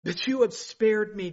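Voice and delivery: ordinary talk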